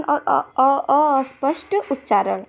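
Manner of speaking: real slip, stammering on अस्पष्ट with a stuck अ- before it; stumbling over a word. Real, not acted. Teacher reading an exam option to students, tripping on अस्पष्ट. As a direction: trip over the start of अस्पष्ट, repeat अ- and then get it out. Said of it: "मेरा भी यहाँ पे थोड़ा अ हो गया".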